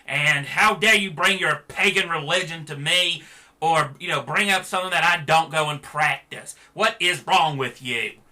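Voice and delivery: hammed-up furious rant at a harmless greeting; mock outrage. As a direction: explode at them as if deeply offended. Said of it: "I just lost my shit and said".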